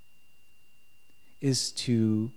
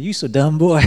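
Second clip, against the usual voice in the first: loud Southern teasing; playful Southern scolding, laugh close behind; Southern accent; 3 semitones higher, 8 dB louder than usual. Acted. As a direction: tease loudly in a Southern accent. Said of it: "'cause he's from the South".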